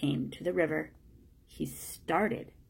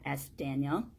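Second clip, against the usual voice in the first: usual speaking voice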